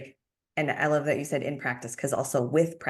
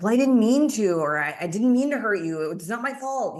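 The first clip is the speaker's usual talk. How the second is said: high, loud defensive protest; 7 semitones higher, 6 dB louder than usual. Acted. Answering a hurt partner, a high loud defensive protest. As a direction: push back to justify yourself. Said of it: "not get defensive".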